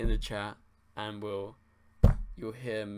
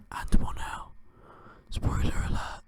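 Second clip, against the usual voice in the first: stage whisper; 10 dB louder than usual. Acted. Teasing a spoiler to chat.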